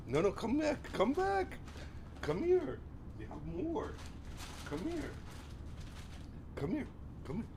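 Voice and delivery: high, soft sing-song coaxing; coaxing the dog. Real, not acted. Owner coaxing Daisy back for a treat, high and soft.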